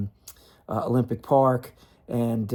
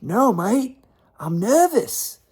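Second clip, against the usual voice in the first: high, slow imitated Aussie confession of nerves; nervous Aussie admission; 10 semitones higher, 4 dB louder, slower than usual. Player in the green room admitting nerves, high slow mock Aussie. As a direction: play Rafter sheepishly admitting nerves.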